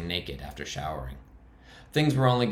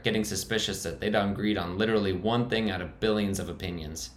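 ordinary delivery, nothing marked

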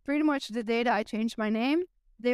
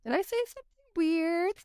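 puzzled worry; 8 semitones higher than usual. Retold or imitated. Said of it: "And I'm like".

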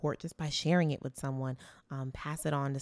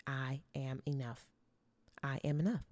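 guided affirmation; 3 semitones lower, 6 dB quieter than usual. Real, not acted. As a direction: lead the listener gently through it. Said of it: "Say it with me".

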